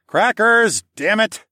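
high, loud frustrated call; exasperated holler. A narrator's reading. Man calling his missing screecher, loud exasperated holler. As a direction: holler the name, then curse. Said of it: "hollering the word crackers over and over".

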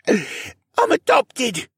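high, strained wheeze after a cough; wheezed out. A narrator's reading. Rylan answers the Dino Man's doubt, wheezing. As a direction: squeeze the words out slowly, high and wheezy. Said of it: "Rylan wheezed out".

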